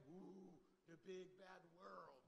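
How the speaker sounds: hushed, slow mock dread; mock spooky hush. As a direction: whisper it like a scary story. Mock scary world.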